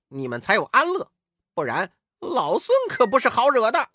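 high, loud, quickening threat as Wukong; cocky Monkey King warning. A narrator's reading. Wukong warns the monks not to rejoice yet, cocky threat. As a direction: warn them sharply, pitched high, building in force.

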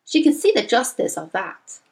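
usual speaking voice

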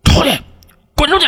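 imitated high-pitched order to get out; mock yelled command; master's shout. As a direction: call it out as an angry master, without full volume. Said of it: "我师傅在后边喊".

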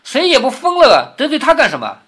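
loud, high-pitched barbed comeback; barbed retort. Acted. Qingwen speaks up from the side about being blamed, barbed. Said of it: "说话带刺的".

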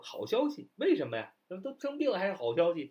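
surprised high question; a doctor baffled by the news; mock surprised doctor asking why; puzzled doctor. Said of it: "医生就很奇怪".

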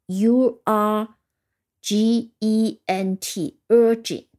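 slow letter-by-letter spelling, then the word; spelling aloud. Shown as a demonstration. Teacher spelling with the learners, slow letter by letter. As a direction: spell it slowly for learners to follow. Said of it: "跟我一起拼一下".

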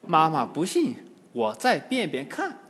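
ordinary delivery, nothing marked

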